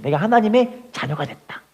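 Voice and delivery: ordinary talk, nothing marked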